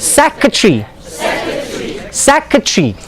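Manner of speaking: "secretary" squeezed to "SEK-ruh-tree"; "secretary" with the ending clipped to "tree"; native pronunciation. Shown as a demonstration. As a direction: say "secretary" in three syllables. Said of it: "It's not secretary".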